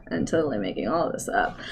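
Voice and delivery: very soft, low, quick confession; quiet aside. Pretend. Game master privately admitting to the players, quiet aside. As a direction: slip it out under your breath.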